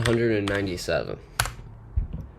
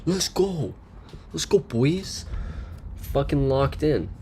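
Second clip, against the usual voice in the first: frat bro; 7 semitones higher, slower than usual. Pretend. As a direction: hype yourself up like a frat bro. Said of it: "hyped bro celebration".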